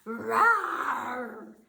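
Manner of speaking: loud bear roar; angry roar. Acted. Bear roars after losing at dreidel, loud. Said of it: "Old Bear roared".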